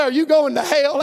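normal talking voice

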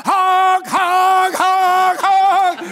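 loud, high honking noise; imitated car horn. Preacher imitating the horn his wife kept sounding, loud honks. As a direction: blare each honk like a car horn.

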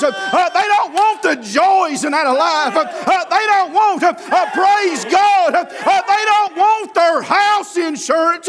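high, driving revival chant; fired-up rhythmic sermon shouting; full-force preaching. Real, not acted. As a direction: preach it hard, climbing to shouts with gasped "uh"s between phrases.

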